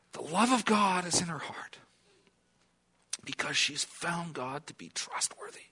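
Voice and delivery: hushed whisper about a faithful woman's heart; whispered awe. Acted. Preacher to congregation, hushed reverent whisper.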